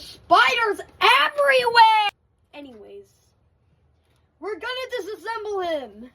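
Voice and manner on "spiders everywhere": high, loud alarmed cry; excited shout. Real, not acted. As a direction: yell it out, freaked by spiders. Spiders crawling on the toy.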